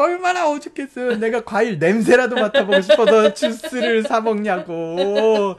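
high, loud, laughing exasperation; exasperated outburst. Retold or imitated. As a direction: vent how desperate it got. Retelling desperation for fruit.

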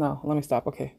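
ordinary talk, nothing marked

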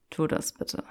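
soft low purr. Acted. Draco inviting Granger to push him, soft purr. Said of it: "schnurrte Draco".

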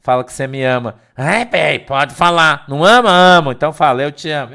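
playful loud teasing. Retold or imitated.

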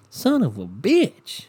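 high, slow, stretched-out angry curse; drawn-out curse. Real, not acted. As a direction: drag the curse out in exasperation. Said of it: "I'm so worked up over here".

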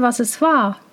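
usual speaking voice

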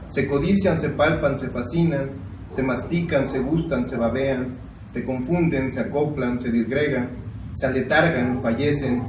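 measured, softening poem reading; poem recitation. Acted. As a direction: read the verb list as a poem. Reads Girondo poem aloud.

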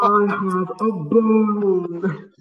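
high, very slow, creepy stalker voice; heavy breathing phone whisper. Pretend. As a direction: drag it out like a creepy caller.

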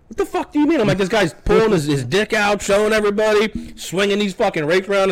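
high, incredulous outburst; exasperated disbelief. Retold or imitated. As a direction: protest as if nobody sees the obvious. Retold argument with the deputy.

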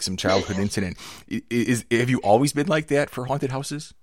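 usual speaking voice

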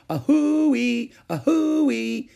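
high, loud train whistle wail. A narrator's reading. Ramona showing off her locomotive noise, wailing loudly. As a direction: wail it out with great feeling. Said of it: "and wailed".

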